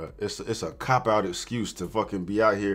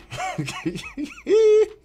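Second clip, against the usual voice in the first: high laugh. Real, not acted. Mocking her excuse.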